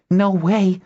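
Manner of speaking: high, indignant refusal; defiant cry. A narrator's reading. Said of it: "Nuella cried".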